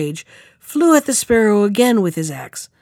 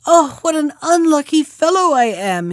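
dismayed exclamation; 7 semitones higher than usual. A narrator's reading. Carter bemoaning his latest loss, exclaiming in dismay. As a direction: exclaim it high, lamenting your luck. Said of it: "he exclaimed again".

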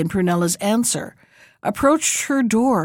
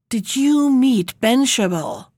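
ordinary delivery

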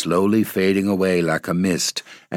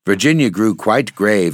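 usual speaking voice

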